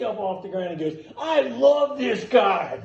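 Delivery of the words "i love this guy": high, loud, slow imitated shout; booming drawn-out declaration; Dennis Rodman's elation. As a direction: holler it slowly with big delight. Acts Rodman grabbing him, delighted.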